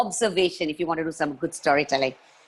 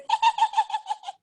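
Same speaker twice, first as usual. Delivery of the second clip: very high squealing laugh; mock high-pitched laugh. Shown as a demonstration. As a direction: squeal out a shrill giggle. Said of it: "few others".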